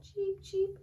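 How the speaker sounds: high little chick chirps; chick cheeps. Acted. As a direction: peep like a tiny chick.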